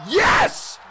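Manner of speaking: huge high yelling "Yes!" of triumph; joyful shout. Real, not acted. Fan yelling at a goal, a huge triumphant shout. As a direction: scream it like your team just scored.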